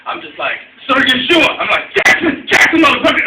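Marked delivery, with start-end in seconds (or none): 0.9-1.5 loud, raised-pitch retold telling-off. Storyteller reenacts telling his bossy friend off, loud telling-off. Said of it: "I just tell his ass off". 2.0-3.2 high, loud retold outburst; telling off. Storyteller reenacts cussing out his bossy friend, high loud telling-off. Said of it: "I just tell his ass off".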